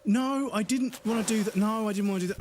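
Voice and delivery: exasperated high whine at the game; whiny high complaint. Real, not acted. Frustrated outburst at game mishap.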